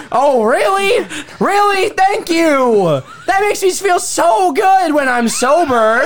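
high, shouted mock gratitude; sarcastic outburst. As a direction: throw back bitter thanks at full pitch. Sarcastic outburst at retold insult.

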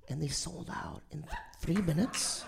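hushed unvoiced aside; whisper. Real, not acted. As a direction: whisper it like a secret. Dramatic whispered reveal.

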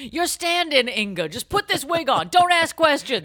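high, loud, pushy orders; bossy. Pretend. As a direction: boss the corpse around. Bossing the corpse around.

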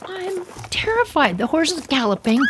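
usual speaking voice

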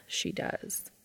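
soft unvoiced whisper. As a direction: breathe the words, no voice. Whispered echo of the line before.

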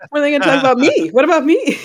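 high, loud self-important outburst; mock indignation. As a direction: squawk it in mock protest. Mock outraged name-drop reaction.

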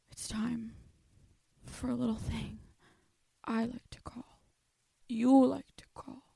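breathy whisper building suspense; spooky whispered intro; whispered teaser. Acted. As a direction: hush it like a secret. Teasing whispered lead-in.

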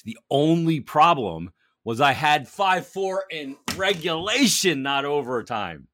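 rueful, drawn-out complaint; rueful emphasis. Real, not acted. Lamenting near-miss bet.

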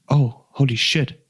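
slow, drawn-out dismay; retold shocked realization. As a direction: mimic fun turning into horrified realization. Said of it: "his facial expression went from this".

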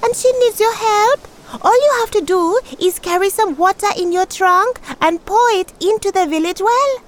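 high, urgent little tortoise; a high, eager tortoise pleading for help. A narrator's reading. Tortoise figure voice.

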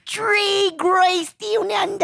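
high, slow Australian accent; exaggerated Australian vowels, drawn out; Down Under accent. Shown as a demonstration. Podcaster shows off their best Down Under dialect, exaggerated Australian accent. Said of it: "in a Down Under dialect".

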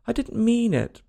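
high-pitched earnest plea; pleading. A narrator's reading. Alice pleads with the offended mouse. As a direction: plead innocence, voice lifted high. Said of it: "pleaded poor Alice".

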